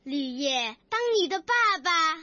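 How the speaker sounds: exaggerated recitation voice; emotional poem recitation. Shown as a demonstration. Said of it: "路船就會為我哋示範佢嘅得獎作品啦".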